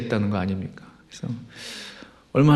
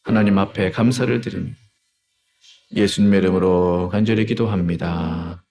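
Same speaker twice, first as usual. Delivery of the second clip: solemn prayer closing; 3 semitones lower than usual. Real, not acted. Pastor closing prayer in Jesus' name, solemn and settling. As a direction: bring the prayer gently down to rest.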